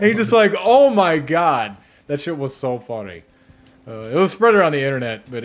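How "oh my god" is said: very slow, louder dawning horror; mock horrified realisation. Imitating Linus, horrified as he learns the meaning. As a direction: let the realisation sink in slowly.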